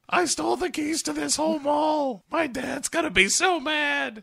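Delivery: very high hammed-up kid voice; bratty kid. Co-host plays the kid confessing wildly, very high voice. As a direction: blurt it out as a naughty child.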